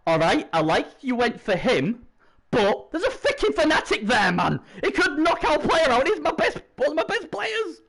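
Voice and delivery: starts reasonable, climbs to high-pitched protest; mounting high exasperation; protesting inner voice. Acted. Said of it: "To me, my brain's saying".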